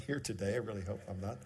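ordinary talk, nothing marked

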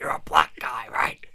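whispered, conspiratorial mockery; mocking whisper. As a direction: hush it like a sly aside. Mocking Vince's thinking.

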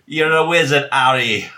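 badly faked accent, loosely Jamaican or Scottish; Hagrid. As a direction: proclaim it in a hammy, muddled accent. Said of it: "Or Hagrid".

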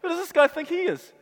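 a hint of an indignant bystander; indignant crowd. Crowd in the temple scoffing at Jesus, mildly indignant. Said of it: "those who are standing around are going".

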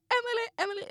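high-pitched calling of a name; retold alarmed calling. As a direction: call out to rouse a collapsed friend. Retold calling to collapsed friend. (orolig, ljus inropning)

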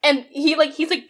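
ordinary delivery

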